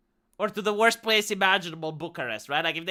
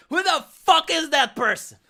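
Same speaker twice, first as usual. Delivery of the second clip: indignant outburst; 6 semitones higher, 6 dB louder than usual. Retold or imitated.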